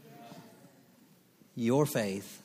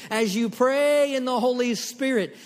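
fiery preaching; 14 semitones higher, 11 dB louder than usual. Acted. Repeated exhortation.

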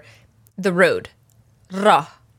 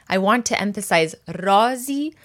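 normal talking voice

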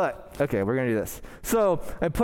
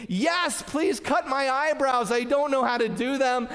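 inner scream; 6 semitones higher than usual. Retold or imitated. Pastor voicing his inner wish at the barber, eager mock scream. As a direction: let the hidden wish burst out, pitched up. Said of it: "Everything in me is screaming".

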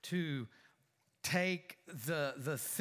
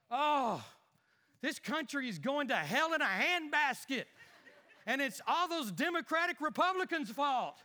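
higher, exasperated ranting at the news; outraged grumbling; 3 semitones higher than usual. Acted. Husband fumes at the news on TV, raised. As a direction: fume at the TV. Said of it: "my thespian ability".

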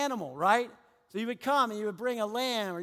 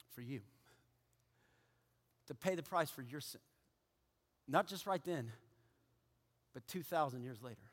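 quiet closing sermon; 7 semitones lower, 8 dB quieter than usual. Acted. Pastor bringing the sermon to a close, softly. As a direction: draw them in with a hush.

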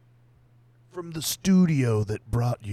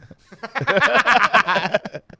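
loud laugh; 12 semitones higher, 12 dB louder than usual. Real, not acted. Big laugh at joke.

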